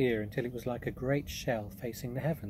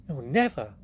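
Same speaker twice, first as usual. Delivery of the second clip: crying out; 7 dB louder than usual. Acted. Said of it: "cried Sophie".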